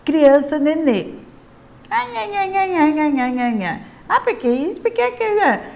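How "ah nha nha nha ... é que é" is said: put-on whiny, high-pitched babbling toddler; put-on little kid whine; baby talk. Shown as a demonstration. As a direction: imitate infantilized nenê speech, nha-nha-nha. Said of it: "fala tati-bitate ... fala de criança nenê".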